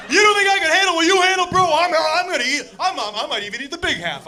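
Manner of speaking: high, loud, fast indignant bro voice; mock-indignant big brother; cocky brother. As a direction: rattle it off, offended and boastful. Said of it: "And he goes".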